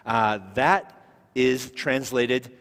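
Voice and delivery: usual speaking voice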